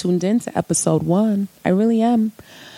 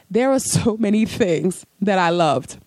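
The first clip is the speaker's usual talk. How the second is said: ordinary talk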